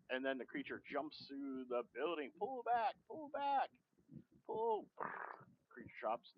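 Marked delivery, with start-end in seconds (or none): 2.4-4.9 very high, rising yells; mock pilot shout. Imitating the helicopter pilot yelling the retreat, rising higher. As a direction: call the retreat in alarm.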